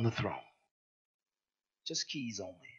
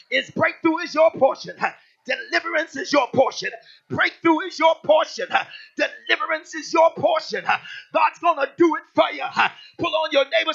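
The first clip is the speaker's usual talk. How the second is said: high, loud preacher calling out over music; preaching at full force; 13 semitones higher, 13 dB louder than usual. Acted. Preacher firing up the congregation, loud full-force preaching. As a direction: rouse the church, shouting the promise.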